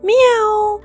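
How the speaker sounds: high sung-out kitty meow; cat meow. Acted. Cat Lily meowing high to the boy.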